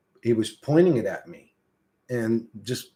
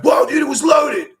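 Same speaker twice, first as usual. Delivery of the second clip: high startled yell, retold; mock panicked brother shouting; brother's startled shout; 9 dB louder than usual. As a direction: shout it like you just got hit. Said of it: "He was like".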